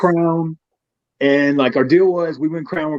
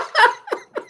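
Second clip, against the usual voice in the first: short high-pitched laugh; high giggle. Real, not acted. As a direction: squeal a quick giggle. Laughs at own story.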